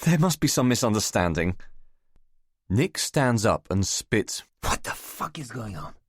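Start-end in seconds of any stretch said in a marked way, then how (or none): 4.6-5.9 hissed, half-voiced whisper; audible whisper. A narrator's reading. Nick hissing at Alec in a whisper. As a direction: spit it out under the breath. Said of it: "in an audible whisper".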